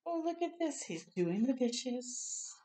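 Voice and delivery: usual speaking voice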